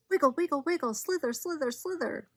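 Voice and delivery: high, rapid-fire singsong; excited wriggling. A narrator's reading. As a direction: make the words squirm like a snake. Snake wriggles on the ground.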